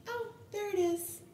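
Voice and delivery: high, light "oh, there it is"; bright surprised sonographer. Retold or imitated. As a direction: lift it up like a pleasant discovery. Sonographer spots the heartbeat.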